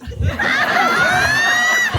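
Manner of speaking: loud, high shouted laugh; big burst of laughter. Real, not acted. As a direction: explode into delighted laughter. Laughing at daughter's answer.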